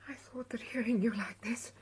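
soft wondering whisper. Acted. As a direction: barely breathe it. Hushed night conversation.

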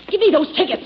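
sharp, loud and high; snatch at the tickets, furious; angry demand. Acted. Nana grabbing evidence from Brooks.

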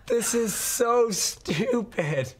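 laughing, exasperated, slow. Real, not acted. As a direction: laugh through the frustration. Laughing at broken interface.

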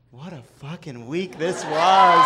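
mock-cheerful, slowed and punchy; sarcastic sunny delivery of bad news; bright and happy. Shown as a demonstration. Host to audience, in a mock bright happy tone. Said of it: "with a bright and happy tone".

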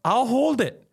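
high, pleading don't-touch-me; high desperate retold plea. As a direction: beg her not to touch, high and strained. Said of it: "I go".

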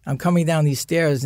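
usual speaking voice

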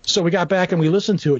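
ordinary delivery

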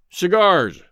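a loud, curt call for cigars; harsh call. A narrator's reading. Cowboy harshly calling to the waiter to hide his emotion. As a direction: bark it across to the waiter. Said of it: "he called harshly to the waiter".

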